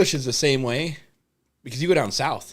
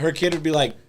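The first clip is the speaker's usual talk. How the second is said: normal talking voice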